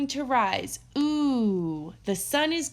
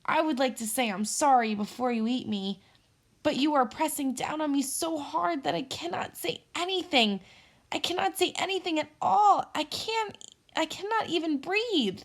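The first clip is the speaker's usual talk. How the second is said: pleading; 6 semitones higher than usual. Acted. Squirrel pleading pinned down.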